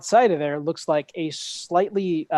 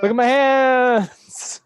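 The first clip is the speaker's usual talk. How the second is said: dazed mammoth voice; 9 semitones higher, 8 dB louder, much slower than usual. Acted. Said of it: "Be like".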